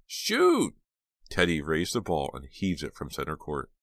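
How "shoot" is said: shrill high scream. Acted. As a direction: scream the one word. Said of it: "Jade and Dina screamed in unison".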